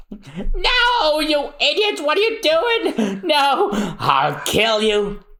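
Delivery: high shrieking panic, then a slow threat; panicked villain scream. Acted. Villain watching his blueprints burn, shrieking.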